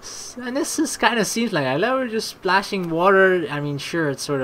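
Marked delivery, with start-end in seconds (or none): none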